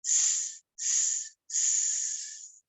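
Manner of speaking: long hissing s sounds; snake hiss. Shown as a demonstration. Storyteller showing kids the snake hiss. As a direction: hiss softly like a sneaky snake. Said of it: "Let's try".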